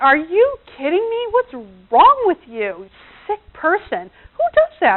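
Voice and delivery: high, loud mock outrage; disbelieving, appalled scolding. Her reacting to his bird photo, appalled outburst.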